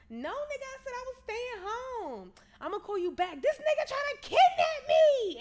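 high-pitched indignant retort building to a yell; loud, high mock alarm by the end; acted outburst. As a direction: act out her protest, voice shooting up. Acted outburst at man on phone.

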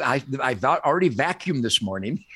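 ordinary talk, nothing marked

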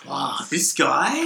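loud, high, drawn-out disbelief; mock outrage. Athlete snubbed by TV reporter, mock outrage. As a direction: act affronted, stretching it out for laughs.

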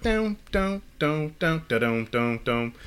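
hummed beat. Retold or imitated. Humming the beat he loves.

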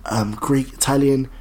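low, soft, hesitant reply; hushed, halting mock confession; imitated undertone. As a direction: mimic someone keeping his voice down. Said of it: "he was proper whispering".

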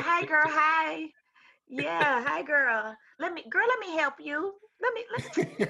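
put-on high, slow, sing-song girlfriend greeting. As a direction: gush like an old friend. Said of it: "I'd be like".

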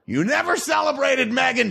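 angry shout. Acted. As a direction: yell them down, fake annoyed. Yelling at people in background.

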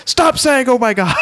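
high mock yell of radio hosts. Radio hosts yelling at the caller, mock yell. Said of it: "until they eventually start yelling at him".

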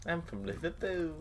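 high, soft mock-Scouse voice; mock Liverpool accent. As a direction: put on a bad Liverpool accent as Irish. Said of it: "I can't do an Irish accent".

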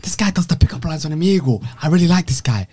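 animated, high-pitched imitation; retold excited recognition. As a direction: raise the voice as if spotting someone you know. Retelling idol's reaction to his video.

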